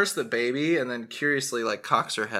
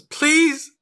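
high, loud pleading cry; desperate mother; 8 dB louder than usual. Acted. Mother begging a patron to spare her daughter, desperate. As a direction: beg frantically in a high voice. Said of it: "And the woman is".

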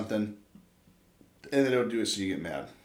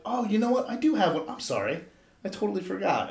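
mock-innocent apology, starting high; put-on nice, high start easing down; feigned forgetfulness; 6 semitones higher than usual. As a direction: act like it just slipped your mind. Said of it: "I'll pretend like I forgot it".

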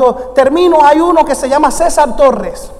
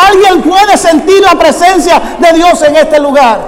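loud, fervent preaching, higher pitched; preaching at full force; 5 semitones higher, 5 dB louder than usual. Acted. Pastor telling the congregation the angel is here, full-force preaching. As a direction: proclaim God's presence with rising fire. Pastor's climactic altar call.

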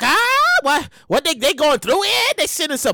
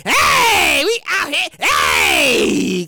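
1 hyped crowd; 4 semitones higher, 3 dB louder, much slower than usual. Pretend. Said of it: "They like".

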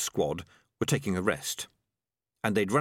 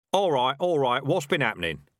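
loud, raised-pitch interruption; brisk demand; 5 semitones higher, 4 dB louder than usual. Acted. Dark Tan pushing past the squad, demanding. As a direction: cut in loudly and take charge. Leader arrives and takes charge.

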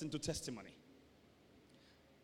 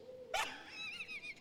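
long, thin high laugh; squealing laugh at the devil; high-pitched laugh; 8 dB quieter than usual. Real, not acted. Laughs after "Cry for what?.

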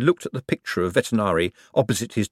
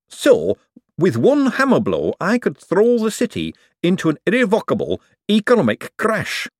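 excited; 11 semitones higher, 3 dB louder than usual. Acted. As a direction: marvel at the power. Hubert awed.

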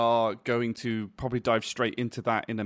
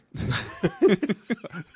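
high laugh; 7 semitones higher than usual. Real, not acted. Laugh after quick intro.

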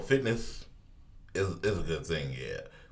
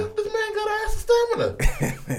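squeaky high voice. Pretend. Mock voice praising Fat Gum.

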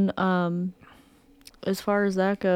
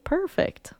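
excited; 12 semitones higher, 4 dB quieter than usual. Retold or imitated. Excited reaction to the financing deal.

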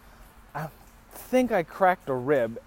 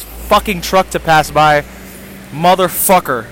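much louder cursing over noise; real irritated shouting; 11 dB louder than usual. Real, not acted. Storyteller interrupted by a passing truck, loud annoyed cursing. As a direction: curse out the noise at full volume.

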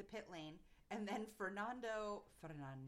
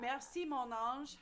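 put-on slow French accent, higher and louder; playful Monegasque driver speaking French; 4 semitones higher, 7 dB louder, slower than usual. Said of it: "I can speak French".

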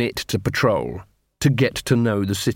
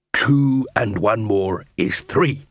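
slow, usual-pitched confirmation; patient dwarf tutor; much slower than usual. Acted. Dwarf constable confirms troll partner's answer, slow and measured. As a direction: repeat the sum slowly, as a tutor.

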